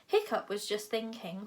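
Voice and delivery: ordinary delivery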